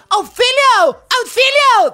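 very high, loud cry of help; woman on the beach screaming for help, high and loud; mock scream for help. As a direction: shriek it like a panicked woman. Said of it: "empieza a gritar una señora".

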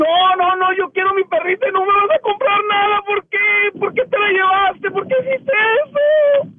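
tearful, desperate protest; llanto real. Real, not acted. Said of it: "Ya no se está llorando". (protesta llorosa y desesperada)

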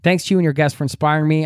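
ordinary talk, nothing marked

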